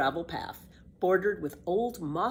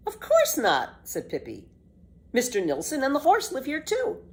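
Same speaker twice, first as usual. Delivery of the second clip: put-on bold, bright-eyed girl, a bit louder; lively girl Pippi; 8 semitones higher, 4 dB louder than usual. Pippi defends walking backward to Tommy, cheeky girl voice.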